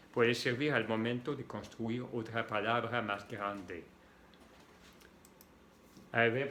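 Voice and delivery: ordinary delivery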